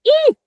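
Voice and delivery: short high startled squeal; mouse squeal. Shown as a demonstration. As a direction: squeal at a mouse running past. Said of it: "you're just like".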